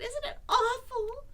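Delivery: high, loud squeak; frightened Piglet. A narrator's reading. Piglet points out the monster, high loud alarm. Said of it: "said Piglet".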